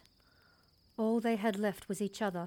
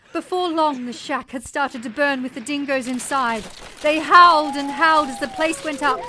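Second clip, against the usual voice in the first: loud, raised, urgent storytelling; intense climactic narration; 6 semitones higher, 12 dB louder than usual. A narrator's reading. Mother recounting the burning shack and dingoes, loud intense narration. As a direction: project the story at full pitch of drama.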